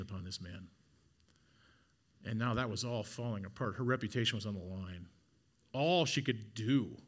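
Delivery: soft, low, reflective preaching; hushed sermon. Acted. Preacher softly sharing Mary's Saturday of grief. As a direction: draw the church into her grief quietly.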